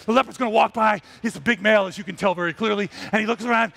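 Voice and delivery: normal talking voice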